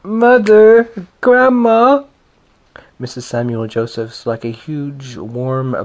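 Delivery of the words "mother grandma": high, loud wail for mother; wailing child. A narrator's reading. Little girl wails after her departing grandmother, high and loud. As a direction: cry out after the leaving buggy. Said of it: "set up a wail".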